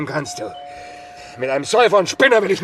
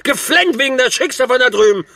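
mocking taunt; 7 semitones higher, 6 dB louder than usual. Mocking his friend's crying.

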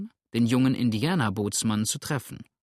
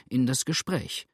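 usual speaking voice

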